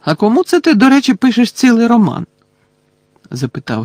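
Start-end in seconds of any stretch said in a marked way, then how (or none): 0.0-2.3 higher, louder questioning voice; curious Ron. A narrator's reading. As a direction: ask it high and loud, prying. Ron questions Hermione in narrated dialogue. (допитливе запитання, високо й голосно)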